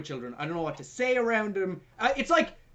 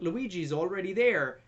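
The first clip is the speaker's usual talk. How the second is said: usual speaking voice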